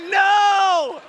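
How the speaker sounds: shouts no in loud, high disbelief; a sudden, loud cry of shock; stunned shout. Retold or imitated. Retold shock at meeting the family.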